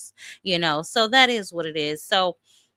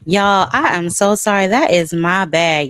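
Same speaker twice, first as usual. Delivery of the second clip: ordinary delivery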